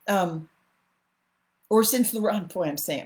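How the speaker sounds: drowsy, sleepy voice; barely awake, drifting off; nodding off. Real, not acted. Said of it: "I'm falling asleep".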